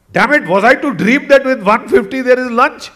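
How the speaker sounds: lost temper. Retold or imitated. Hungry traveller berates the staff over lunch, angry outburst. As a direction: protest loudly like an outraged customer. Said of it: "he lost his temper".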